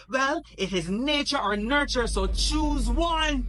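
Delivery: high, loud furious yell, slowing at the end; loud high shout, slow on choose one. Acted. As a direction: yell it at him, drawing out the last words. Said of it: "yelled at Jesus".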